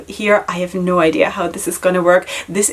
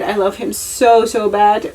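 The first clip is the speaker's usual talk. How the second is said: ordinary talk, nothing marked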